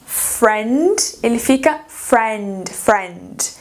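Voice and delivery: "friend" with a short open E, slow; teacher modelling "friend" for students, short E. Shown as a demonstration. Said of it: "um I e um E".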